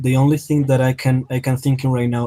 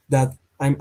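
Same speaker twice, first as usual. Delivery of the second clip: usual speaking voice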